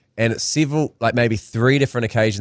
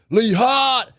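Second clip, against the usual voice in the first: mock yell; 11 semitones higher than usual. Comedy crowd member answers the comedian, mock yell. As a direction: imitate a heckler calling out, pitched way up. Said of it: "someone yelled out".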